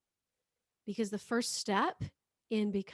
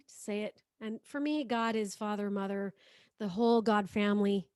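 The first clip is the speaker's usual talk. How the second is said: ordinary talk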